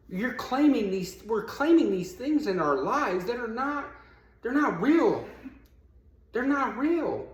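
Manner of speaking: high, urgent preaching push; rising sermon fervor; fervent preaching. Acted. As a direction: drive the point home to the congregation. Preaching at the congregation.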